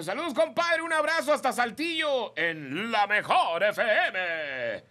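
high, loud put-on voice, slowed down; mock listener voice. Host reading Pequeño's shout-out in a hammed-up high voice. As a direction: greet the station like a cartoon caller. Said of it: "dice Pequeño".

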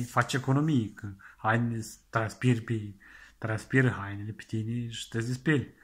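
ordinary delivery

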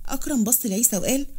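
plain reading voice

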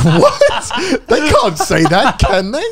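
high, laughing mock shock; incredulous. Viewer reacting to the film's line, incredulous. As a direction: stretch it out in scandalised disbelief.

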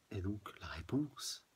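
slow ominous whisper; hushed sinister reply. Acted. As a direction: breathe the answer like a secret. Reads ominous answer.

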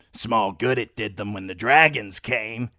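sly, mocking old man's voice; crude old lord. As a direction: play a toothless, spiteful old lord. Old Walder Frey voiced.

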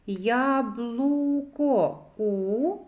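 slow "Яблуко", then a long "У"; sounding out. Shown as a demonstration. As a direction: stretch "Яблуко" and hold the vowel "У" alone. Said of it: "зробимо звуковий аналіз слова яблуко".

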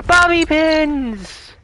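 gasping with delight, high and slow; excited gasp. Real, not acted. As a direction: gasp then cry out the find. Excited find of bobby pins.

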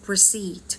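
"receipt" said with no P sound; silent P. Shown as a demonstration. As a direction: say "receipt" as re-SEET, P left silent. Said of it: "ตัว P เราไม่ออกเสียงนะ". (ออกเสียง "receipt" แบบไม่ออกเสียงตัว P)

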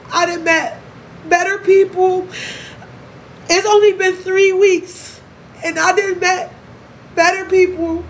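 holding back tears while telling fans; emotional. Real, not acted. Talking to followers, voice lifted high and close to tears. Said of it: "I told you I'm very emotional today".

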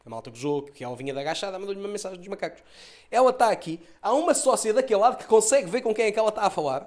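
ordinary talk, nothing marked

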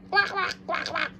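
high, rapid duck imitation; duck quacking. Toy duck met at the pool, high playful quacking.

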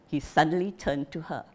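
usual speaking voice

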